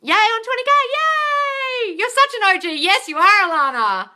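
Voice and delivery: high, loud gushing praise; excited cheering. Real, not acted. Live host gushing over a viewer: high, loud excited cheer. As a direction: hype up the viewer.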